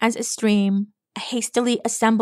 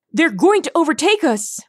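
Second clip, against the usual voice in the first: high, panicky cry; alarmed exclamation; 7 semitones higher, 3 dB louder than usual. A narrator's reading. A unicorn as spears fly at the group, alarmed exclamation. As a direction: cry out in alarm, pitch jumping. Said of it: "another unicorn exclaimed".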